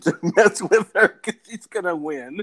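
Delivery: real laughter bubbling through the punchline; laughing while talking. Real, not acted. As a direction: squeeze the words out through laughter. Laughing through end of own story.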